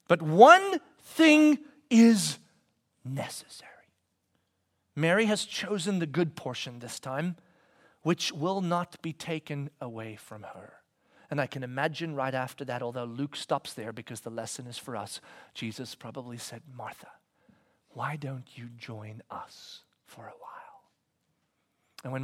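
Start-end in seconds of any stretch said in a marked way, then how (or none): 0.1-3.7 "But one thing is" drawn out very slowly and loud, "necessary" whispered; slow and weighty, dropping to a whisper on "necessary"; slow stressed phrase. Shown as a demonstration. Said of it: "an incredibly important word". 16.7-20.8 gentle whisper. Acted. Jesus gently invites Martha.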